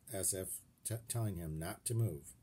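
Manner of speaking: ordinary delivery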